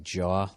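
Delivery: "jaw" with a broad open aw and no b; Boston vowel in jaw. Shown as a demonstration. Host coaching Boston accents, "jaw" as the vowel model. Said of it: "just say, like".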